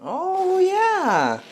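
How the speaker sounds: high delighted squeal; thrilled cry of delight; excited. Real, not acted. Pizza comes out of pan.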